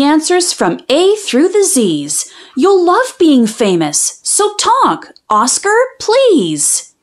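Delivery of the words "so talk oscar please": higher, slow begging please; drawn-out plea. Acted. Child begging her dog to talk, slow pleading.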